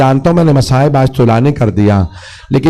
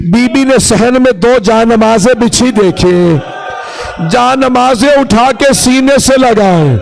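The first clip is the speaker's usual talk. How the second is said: raised, impassioned mourning narration; lament preaching; 4 semitones higher than usual. Acted. Preacher recounts Zainab's return home to mourners, lamenting. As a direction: tell the tragedy with mounting grief.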